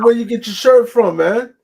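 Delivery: quoting fans. Retold or imitated. Streamer quoting fans asking about his shirt, loud and eager. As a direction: ask like an excited fan. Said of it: "they said".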